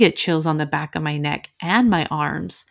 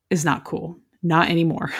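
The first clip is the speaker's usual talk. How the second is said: usual speaking voice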